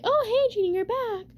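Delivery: high, loud sing-song hello; cheery greeting. Retold or imitated. Sister greets Jeannie coming home, high cheery hello. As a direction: greet her brightly from the bathroom door.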